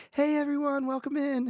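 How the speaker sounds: high, chirpy shop greeting voice; customer service voice. Retold or imitated. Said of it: "their voice goes three octaves higher".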